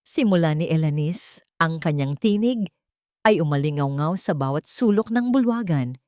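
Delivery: ordinary delivery, nothing marked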